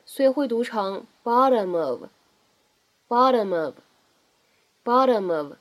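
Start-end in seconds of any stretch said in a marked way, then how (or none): 1.2-5.5 "bottom of" run together as "bah-duh-mov"; linking. Shown as a demonstration. Teacher shows linking, "bottom of" joined as one. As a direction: carry the m of "bottom" straight into "of". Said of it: "我们可以很迅速的呢带一个连读".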